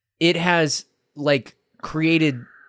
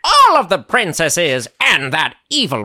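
scheming villain, swooping pitch; cartoon villains. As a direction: ham it up like a plotting villain. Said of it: "the villains are like".

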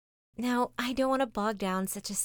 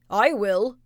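loud gruff bark of a dog; lazy dog barking; 2 semitones higher, 11 dB louder than usual. Acted. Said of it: "barked the lazy dog".

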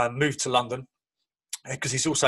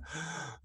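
awed gasp; 3 semitones higher, 15 dB quieter than usual. Retold or imitated. Son watching World Cup clips.

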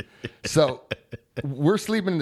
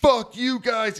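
higher retold cursing; mock angry outburst; 9 semitones higher, 4 dB louder, slower than usual. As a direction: imitate a fed-up friend blowing up. Retold outburst of pranked friend.

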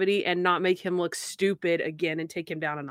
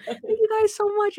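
mock grateful hobo; 12 semitones higher than usual. Imitating hobos' thanks.

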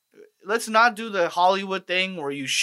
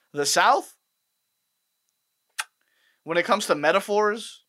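ordinary talk, nothing marked

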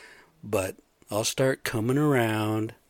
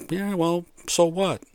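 higher, mock shrug of a careless friend; dismissive buddy; 8 semitones higher, slower than usual. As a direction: toss it off like it doesn't matter. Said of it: "I got some buddies like".

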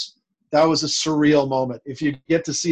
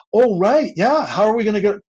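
sudden high, louder oh right; lightly hammed-up dawning surprise; mock realization; 6 semitones higher, 5 dB louder than usual. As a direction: play the producers catching on too late. Producers realizing they need oysters.

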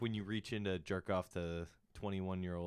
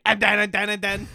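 put-on drunk friend voice; 11 semitones higher, 14 dB louder than usual. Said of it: "He's like".

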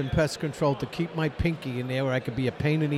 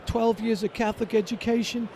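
voicing the mother; 6 semitones higher than usual. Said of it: "my mother".

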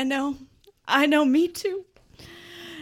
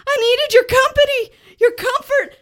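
higher and louder, tearful; hammed-up crying; 11 semitones higher, 10 dB louder than usual. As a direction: keep wailing the list. Simon's tearful reproach.

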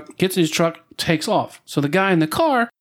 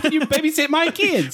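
mock favor request; 11 semitones higher than usual.

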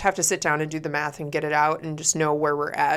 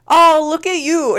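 put-on high, loud, drawn-out Northern accent; hammed-up Northern accent; most Northern accent; 10 semitones higher, 8 dB louder, slower than usual. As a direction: stretch it out in exaggerated Northern. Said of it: "use the most northern voice".

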